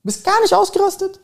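very high, loud squeaky put-on voice; mock disbelief. Real, not acted. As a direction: squeal the question in teasing disbelief.

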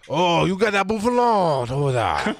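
hammed-up fake French accent. Shown as a demonstration. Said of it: "little fake French on that thing there".

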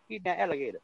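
mock the Cajun characters; hammed-up Cajun voice; mock Louisiana accent. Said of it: "the Louisiana accent".

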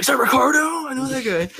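high, loud burst of recognition; excited recognition. Retold or imitated. Retells spotting someone they know.

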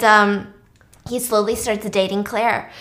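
usual speaking voice

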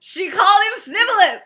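high, very loud exclamation; crowing in disbelief at a savage insult; gleeful outrage. Real, not acted. Thrilled shock at the insult.

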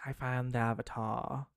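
put-on quiet British-accented line; understated British accent, dropping the final r. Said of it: "said it in a British accent".

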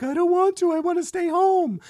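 put-on high, loud wailing child protest; crying kid. Dad imitating his kids refusing to leave mom, high wailing child. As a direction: whine it like a small kid refusing. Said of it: "they'll be like".

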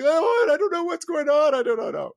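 high, loud mock wail of confusion; flustered official. Mocking Fed officials caught out by the bond market, helpless wail. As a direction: wail it out cluelessly, then tail off. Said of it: "you're gonna have to come out here and like".

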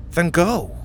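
encouraging. A narrator's reading. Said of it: "Mac encouraged".

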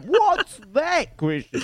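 high, slow mock outrage at the question; hammed-up indignant caller, drawn out; mock fuming caller. Retelling the caller's reaction to the co-host, mock fuming. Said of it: "he was fuming".

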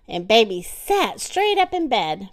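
ordinary delivery

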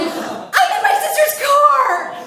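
very high, drawn-out shriek; panicked wail. Retold or imitated. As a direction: wail it hysterically, slowly stretched out. Panicked caller answering operator.

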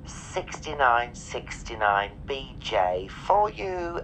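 mock flight attendant PA announcement over music; deadpan cabin-crew read to the passengers; airline announcement.

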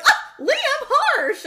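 high, loud mock lament; fake crying. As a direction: wail "Liam" in hammed-up grief. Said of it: "And you were like".

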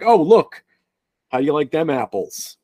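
put-on high, loud movie-Boston accent; mock Boston accent. Podcaster mocking overused movie accents, exaggerated Boston accent. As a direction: quote a film line in playful Boston. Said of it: "than the Boston accent".

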